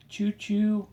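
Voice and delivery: choo choo sung up high like a toy train; a bright, high, playful choo choo; high train call. Acted. Parent reading to toddler, high playful train call. Train sound in kids' book.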